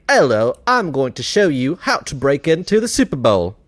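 mock British presenter accent; Jason Statham. Host telling friends about a British stunt show, mock British accent. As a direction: put on a cheeky British TV host's accent. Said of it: "your first voice was, like, Jason Statham".

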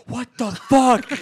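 high, loud, drawn-out mock yell; outraged kid. Player imitates the kid killed by a flying car, outraged high yell. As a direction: imitate a kid's indignant outburst.

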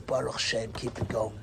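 low, soft heartfelt murmur; quiet murmur. Retold or imitated. Rabbi voicing his response to seeing devotion, quiet murmur. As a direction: say it low, almost to yourself.

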